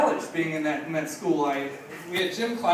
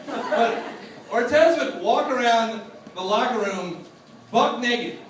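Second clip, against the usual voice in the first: ordinary talk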